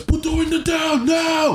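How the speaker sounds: mock cop shouting an order; slow shouted order. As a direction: drag out the demand, shouting. Man demanding window down again.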